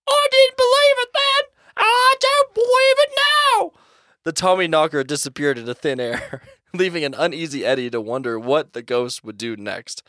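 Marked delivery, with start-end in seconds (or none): 0.1-3.7 very high, loud, slow goblin squawk; disbelieving Tommyknocker. Acted. Tommyknocker refuses to believe Eddie, high slow squawk. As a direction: reject the excuse in a piercing cartoon voice.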